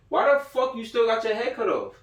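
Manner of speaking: ordinary talk, nothing marked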